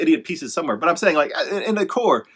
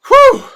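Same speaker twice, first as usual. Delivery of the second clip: sharp high whew of release; loud whew; 15 dB louder than usual. Real, not acted. Vlogger letting out a big whew before talking. Exhale before starting vlog.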